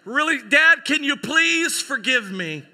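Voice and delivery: loud, high, drawn-out plea; hammed-up begging. Graduate begs dad's forgiveness at the mic, loudly overdone. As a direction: beg at full volume, milking each word.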